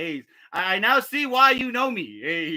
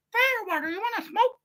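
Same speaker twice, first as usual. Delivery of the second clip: put-on little kid voice; 11 semitones higher, 3 dB quieter than usual. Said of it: "little fucking five-year-old come out".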